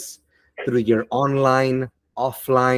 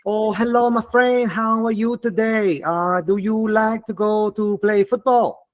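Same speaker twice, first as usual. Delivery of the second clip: put-on higher, sing-song Vietnamese-accented English; mock Vietnamese-accented small talk; 7 semitones higher than usual. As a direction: play a Vietnamese learner speaking English. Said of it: "if you speak English with a Vietnamese accent".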